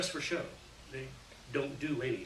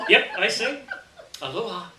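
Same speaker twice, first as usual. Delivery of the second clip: laughing; 6 semitones higher, 7 dB louder than usual. Real, not acted. Laughing through goodbye jokes.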